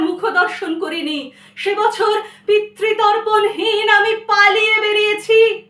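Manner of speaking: high, loud, mounting lament; anguished poetry recitation. Acted. Child grieving mother's death, anguished recitation.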